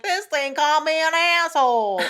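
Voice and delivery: high, loud imitated Southern accent. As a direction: exclaim indignantly in mock Southern. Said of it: "trying to do a Southern accent".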